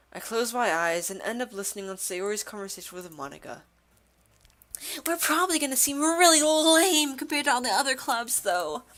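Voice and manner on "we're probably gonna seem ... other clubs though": high-pitched, complaining character voice; gloomy club member. Acted. Club member fretting about the festival, doubtful. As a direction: whine it in a higher voice.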